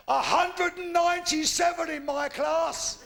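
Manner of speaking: hammed-up outraged shout; comic shout. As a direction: bellow the exaggeration. Comic exaggeration punchline.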